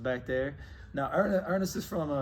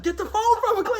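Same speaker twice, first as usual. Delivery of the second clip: high, loud laughing shout; frantic mock yell, cracking up; yelled through laughter; 10 dB louder than usual. Friends yelling at Ernest to grab the phone, laughing shout.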